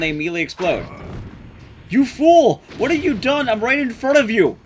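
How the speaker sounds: normal talking voice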